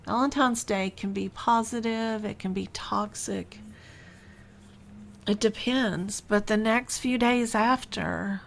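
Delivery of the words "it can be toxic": hushed, breathy aside about toxic love; a secret warning, whispered. Real, not acted. Calls Valentine's Day possibly toxic.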